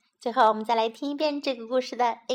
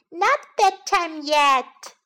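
high, loud, slow refusal; little bunny; 5 semitones higher, 6 dB louder than usual. A narrator's reading. Little bunny refusing bedtime to Mummy, high slow protest. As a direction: stretch it out like a stubborn child. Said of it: "said little Bunny".

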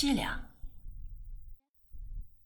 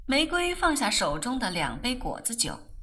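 ordinary delivery, nothing marked

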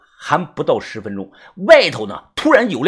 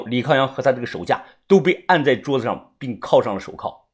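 ordinary delivery, nothing marked

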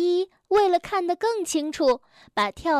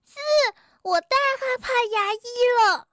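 scared; 9 semitones higher, faster than usual. A narrator's reading. Scared Bengbeng admits fear.

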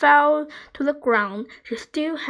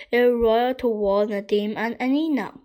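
plain reading voice

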